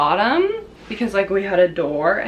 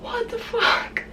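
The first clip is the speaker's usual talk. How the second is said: stunned whisper; 13 semitones higher, 5 dB quieter, slower than usual. Retold or imitated. Mom reacting in disbelief.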